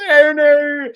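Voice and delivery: high, loud, laughing imitation of a wail; hammed-up cry of someone falling; mock falling cry. Echoing the co-host's falling caver, high loud mock wail. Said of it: "She goes".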